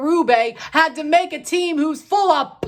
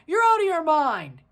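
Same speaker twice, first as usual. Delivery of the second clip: normal talking voice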